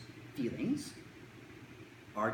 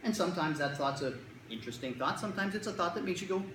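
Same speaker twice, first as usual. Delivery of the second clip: usual speaking voice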